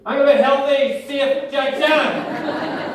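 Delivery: high, loud, dragged-out declaration; mock proud shout. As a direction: stretch out the last words triumphantly. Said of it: "And then my brain went".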